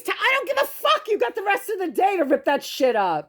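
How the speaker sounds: high, heated yelling at him; angry outburst. Real, not acted. To husband ripping boxes during filming, angry high outburst. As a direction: let the frustration explode. Arguing with husband over noise.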